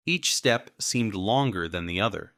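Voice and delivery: ordinary delivery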